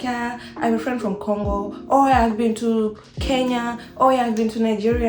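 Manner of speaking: light imitation of eager small talk; mock eager locals. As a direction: play people showing off African places. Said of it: "they're like".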